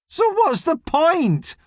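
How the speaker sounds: high, slow despairing wail. A narrator's reading. James wails to Thomas, high and slow. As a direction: whine it out like a hopeless kid. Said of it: "wails James".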